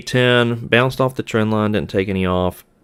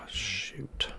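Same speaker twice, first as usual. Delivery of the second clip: breathy whispered "shoot" under the breath; whispered curse; 17 dB quieter than usual. Real, not acted. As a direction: mutter it almost silently to yourself. Quiet curse after losing trade.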